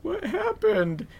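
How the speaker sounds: very high, soft, drawn-out question; hushed shock. Retold or imitated. Candace looking at him, imitated hushed shock. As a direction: ask it high and small, slowly.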